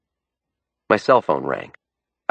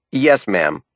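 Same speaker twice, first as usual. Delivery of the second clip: ordinary delivery, nothing marked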